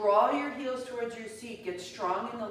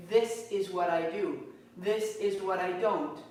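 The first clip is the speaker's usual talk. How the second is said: ordinary delivery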